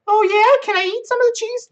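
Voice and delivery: put-on squeaky kid begging for cheese; little kid voice. Kid self voiced in story.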